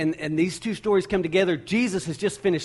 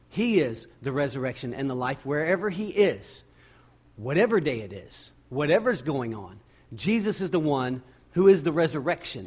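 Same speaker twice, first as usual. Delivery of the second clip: ordinary delivery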